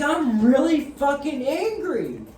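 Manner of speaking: loud, high, slow retold outburst; angry yelling. Man yelling at girlfriend for no reason, angry. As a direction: stretch each word out in a high yell. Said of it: "me yelling at her".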